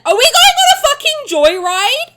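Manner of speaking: loud, high, laughing shout; excited laughing yell. Real, not acted. Streamer shouting at the trailer, excited and laughing. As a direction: blurt it out gleefully, laughing loudly.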